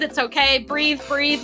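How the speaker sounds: ordinary talk, nothing marked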